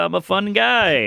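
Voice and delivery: aloof Norm impression. Describing Norm's aloof delivery, slow light deadpan. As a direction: toss it off coolly, a hint of Norm. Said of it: "he's like a Canadian aloof".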